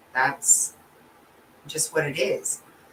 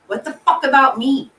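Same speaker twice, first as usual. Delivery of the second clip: loud, raised-pitch outburst of grievance; angry venting; 6 semitones higher, 8 dB louder than usual. Retold or imitated. Mourner venting alone in the shower, angry outburst. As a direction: throw it out loud, demanding to be counted.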